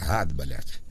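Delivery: ordinary talk, nothing marked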